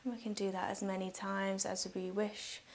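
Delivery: soft, unhurried meditation voice with long pauses; guided meditation. Real, not acted. Yoga teacher guiding listeners through breathing, calm guided meditation.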